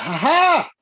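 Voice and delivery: high, loud spoken "ha ha"; mock laugh. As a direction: act out a triumphant laugh. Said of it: "I laughed at last".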